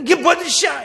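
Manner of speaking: sudden high, loud acted rebuke; indignant onlooker. Bystanders judging the woman's act, mock indignant shout.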